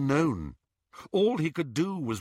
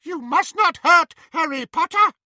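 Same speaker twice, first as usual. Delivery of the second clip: high, louder squeaky elf voice; squeaked; 13 semitones higher, 8 dB louder than usual. A narrator's reading. Dobby defying his old mistress, squeaky elf. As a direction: squeak it bravely like a tiny elf. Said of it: "he squeaked".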